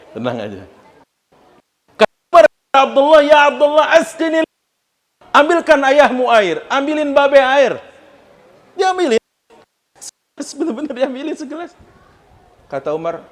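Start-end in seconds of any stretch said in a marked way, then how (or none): 2.3-11.7 high, loud, slowed sermon peak; fervent preaching. Acted. Preacher raises Umar's plea to the congregation, loud and high. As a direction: cry it out to the hall.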